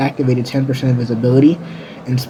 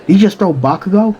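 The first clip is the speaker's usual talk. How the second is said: high-pitched disbelief; astonished question; 7 semitones higher, 4 dB louder than usual. Acted. Someone in the crowd saw the throw, astonished. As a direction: ask it like a stunned bystander.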